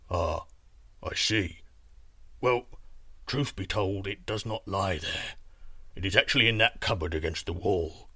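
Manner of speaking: low, softened giant rumble; moved giant confessing; touched giant. Acted. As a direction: admit it haltingly in a deep giant voice.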